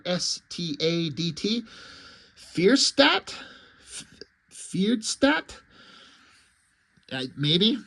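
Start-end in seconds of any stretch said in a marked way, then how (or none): 2.4-3.3 Fierstadt said FEER-shtat, rising like a question; unsure guess. Shown as a demonstration. Vlogger guessing a comic town name, unsure. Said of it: "I don't know how to pronounce this". 4.5-5.6 Fierstadt tried again as FEERD-stat, rising; second guess at Fierstadt, questioning; unsure retry. Shown as a demonstration. Vlogger tries the town name again, unsure. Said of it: "I don't know how to pronounce this".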